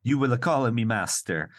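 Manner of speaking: hammed-up Italian accent. Bossing a woman on the island, mock Italian accent. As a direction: play a domineering Italian man.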